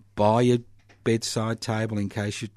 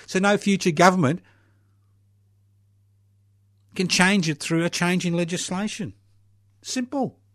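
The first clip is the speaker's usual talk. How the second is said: exasperated rant; 7 semitones higher than usual. Real, not acted. Radio host demands a referendum, exasperated and raised.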